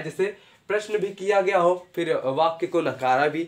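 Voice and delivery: ordinary talk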